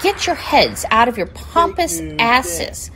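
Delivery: ordinary delivery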